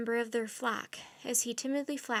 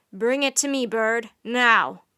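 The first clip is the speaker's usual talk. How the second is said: louder, harsh order; angry command; 10 dB louder, slower than usual. A narrator's reading. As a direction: demand it sharply. King orders the raven.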